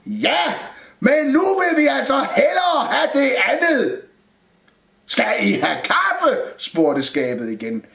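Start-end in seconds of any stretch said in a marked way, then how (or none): 0.1-4.0 high, loud exasperated reply; shouted insistence. A narrator's reading. Shouted argument with the cupboard. 5.1-6.4 slow, high cupboard holler; shouted question. A narrator's reading. Cupboard asks again in shouting exchange.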